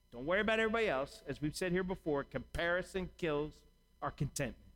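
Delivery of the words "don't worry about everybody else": fast, brushing-off reassurance; quick dismissal. Acted. God telling believers not to compare, fast. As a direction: rattle it off fast, waving worry away. God's words quoted quickly.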